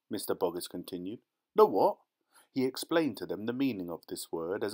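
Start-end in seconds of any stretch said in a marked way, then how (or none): 1.5-2.0 sharp high baffled "The what?". Acted. Farmer interrupting the dealer, loud and baffled. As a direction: jump up in pitch, loud and puzzled.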